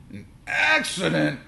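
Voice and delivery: very high, loud, slow howl; angry howl. A narrator's reading. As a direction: howl it back in disbelief. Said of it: "howled my dad".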